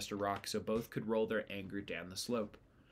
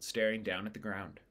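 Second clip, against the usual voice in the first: ordinary delivery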